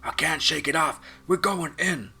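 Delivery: high, loud cry of a pilot going down; panicked shout. Acted. Drone pilot shouting to his team as he crashes. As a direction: yell it as the plane spins in. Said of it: "The drone pilot called out".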